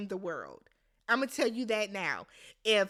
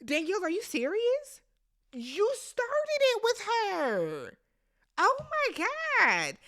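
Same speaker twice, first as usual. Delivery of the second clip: high, slow, drawn-out disbelief; talking back at the screen in disbelief; exasperated disbelief; 7 semitones higher than usual. Real, not acted. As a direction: draw it out like she can't believe it. Scolding a player on screen.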